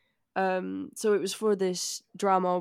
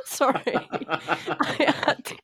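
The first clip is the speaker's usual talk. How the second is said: laughing; 5 semitones higher, slower than usual. Real, not acted. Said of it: "couldn't hold myself back".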